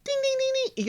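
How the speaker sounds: high sung-out ding ding ding; mock bell ding. Host mimics the boxing bell over the couple's fight, mock bell ding.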